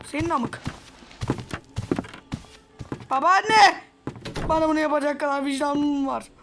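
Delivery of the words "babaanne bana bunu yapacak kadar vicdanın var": shouting for granny. Real, not acted. Player yelling to find granny. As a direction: yell her name across the house.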